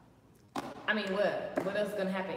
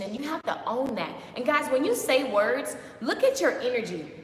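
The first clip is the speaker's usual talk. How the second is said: ordinary delivery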